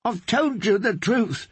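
high pleading with a touch of a sob; mild sobbing. Acted. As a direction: beg through understated tears. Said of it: "the singer sobbed".

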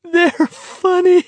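very high, helpless squeal; nearly weeping with laughter. A narrator's reading. Garrity answers his worried friend, nearly weeping with laughter. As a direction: squeeze the words out through hysterics. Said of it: "He was nearly weeping with laughter now".

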